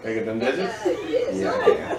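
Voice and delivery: laughing through the line; giggling while speaking. Real, not acted. Mock pickup line while laughing.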